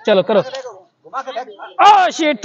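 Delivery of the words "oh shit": sharp louder outburst; dismayed exclamation. Real, not acted. Host seeing a child's flip fail, dismayed. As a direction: groan at the miss.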